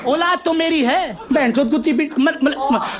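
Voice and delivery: high Haryanvi rant against the school; angry Haryanvi mother. Acted. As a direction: rant like an offended Jat mum. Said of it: "देसी हरियाणा की जाट".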